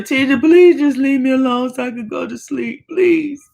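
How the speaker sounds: high, whiny begging; pleading child. Retold or imitated. Child self begging sister.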